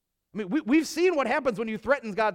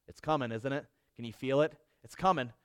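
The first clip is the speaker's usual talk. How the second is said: hushed suspense; 7 semitones lower, 5 dB quieter than usual. Acted. Building suspense for listeners.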